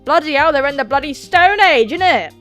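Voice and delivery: loud, high hammed-up exclamation; mock outrage. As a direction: exclaim it in exaggerated British indignation. Mock outburst about outdated keys.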